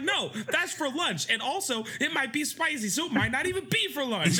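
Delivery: high lecturing imitation; high wife quote. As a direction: explain it as if obvious. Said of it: "she was like".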